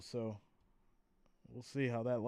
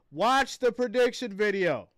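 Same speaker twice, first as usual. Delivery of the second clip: exasperated; 7 semitones higher, 8 dB louder than usual. Real, not acted. Exasperated reply repeated.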